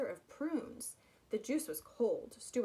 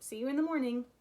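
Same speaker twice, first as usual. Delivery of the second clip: louder, higher call; 5 semitones higher, 7 dB louder, faster than usual. Acted. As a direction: call across the room. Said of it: "called Stuart".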